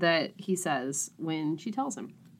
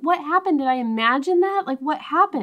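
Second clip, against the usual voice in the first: high, baffled questioning; mock bewilderment; 8 semitones higher, 3 dB louder than usual. Wife baffled by his sudden mood change, mock bewildered. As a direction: ask in disbelief, pitched well up.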